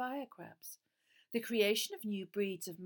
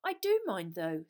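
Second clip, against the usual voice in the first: hotly; 9 dB louder than usual. A narrator's reading. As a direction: push back, indignant. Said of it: "said Harry hotly".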